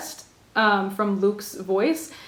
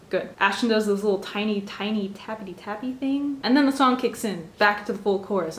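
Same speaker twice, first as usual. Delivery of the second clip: ordinary delivery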